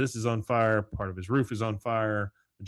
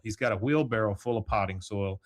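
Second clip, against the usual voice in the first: ordinary delivery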